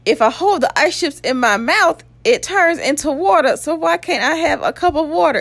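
high, exasperated mock pleading; retold pissed-off protest. Thirsty patient arguing with nurse for water, retold exasperation. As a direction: argue it out in a climbing, exaggerated pitch. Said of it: "So I was pissed about that".

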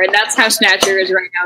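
ordinary talk

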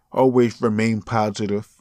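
ordinary talk

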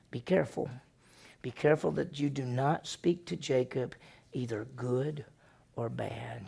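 quiet, weighty caution from above; hushed divine warning. Acted. God warns Laban in a dream.

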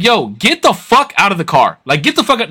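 retold angry order. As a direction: re-enact ordering a driver out. Retells ordering driver out.

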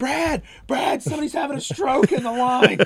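very high, hammed-up alarmed cry; high-pitched mock-frantic employee; panicked drive-thru worker. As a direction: call for help in a squeaky mock panic.

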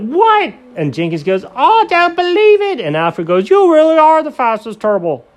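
very high, loud amazement; high stunned sidekicks; squeaky amazed voices. Acted.

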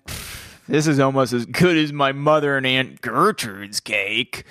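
slow, scoffing mock praise; scoff, then drag out the backhanded compliment; dismissive reading. Shown as a demonstration. Cohost shows how the cake line could be kidding, slow and scoffing. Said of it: "it's read as in".

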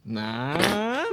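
drawn-out, doubtful reaction, creeping upward; unenthusiastic. Real, not acted. Co-host reacting to the Joe Pesci recast, unenthusiastic. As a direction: stretch it out, unconvinced. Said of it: "less enthusiastic".

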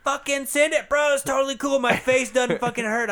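high-pitched hype bro voice; frat bro. Pretend. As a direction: play the dumb bro brushing off pain. Mocking the crashed rider.

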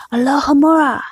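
usual speaking voice

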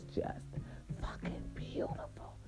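hushed, breath-only whisper; awed whisper. Real, not acted. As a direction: savour it in a very soft whisper. Savouring the beautiful spot.

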